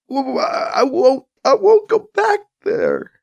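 high, slow, breaking into sobs; a frightened sob rising to a cry. Acted. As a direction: stammer it out through tears. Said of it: "breaks down crying".

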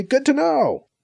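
high-pitched sarcastic lilt; mock-appreciative, pitched well up. A narrator's reading. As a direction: pretend to be grateful for the news. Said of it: "B C says sarcastically".

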